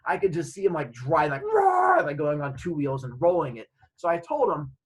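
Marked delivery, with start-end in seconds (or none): 1.4-2.0 high, loud mock engine roar. Imagining Fish speeding off, high loud engine roar. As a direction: voice the car revving hard.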